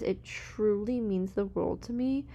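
ordinary talk, nothing marked

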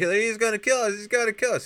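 very high, loud squeal; mock panicked scream. Elves screaming as the sleigh flies off, hammed-up panic. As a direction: imitate shrieking in terror. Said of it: "the elves start screaming".

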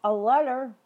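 sudden loud blurt; loud exclamation. Real, not acted. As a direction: blurt it out loudly.

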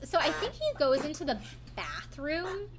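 ordinary talk

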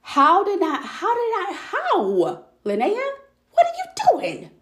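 high-pitched, exasperated self-scolding; retold self-directed anger. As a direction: scold yourself in squealing disbelief, replaying the moment. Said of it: "I was so angry".

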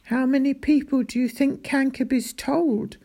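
mild alarm in the question; worried. A narrator's reading. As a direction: ask with growing concern. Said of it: "now worried".

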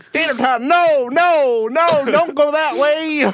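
high, loud panicked race call; excited race caller. Pretend. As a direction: cheer the horse on in a frantic call. Joins the mock race call.

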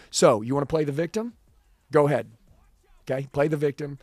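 loud, punchy retold dare; confrontational challenge. Wife calling out her husband, loud challenge. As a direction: throw down the challenge forcefully, acting out a confrontation.

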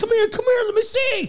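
high, urgent mock yell; alarmed high yell. The guys call Christine over in high mock alarm.

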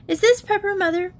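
put-on high childlike question; innocent little girl. A narrator's reading. Sharon holding up pepper to mother, innocent child. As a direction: ask sweetly and unaware.

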